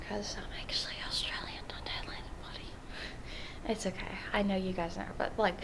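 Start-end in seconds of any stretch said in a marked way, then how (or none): 0.1-2.7 hushed secret aside; confiding whisper. Pretend. Telling viewers a secret, confiding whisper.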